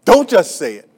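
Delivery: loud, high, insistent sermon climax; preaching at full force. Real, not acted. Preacher urging the church to pray for haters. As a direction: build and hammer it to the congregation.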